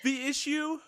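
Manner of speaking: "issue" slurred around a freshly bitten tongue, voice jumping high; just bit tongue. Real, not acted. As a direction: say "issue" as the bite hits. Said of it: "ow, I bit my tongue".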